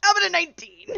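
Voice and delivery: loud, high triumphant brag with a laugh; excited. Real, not acted. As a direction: crow about the roll. Rolls high on history check.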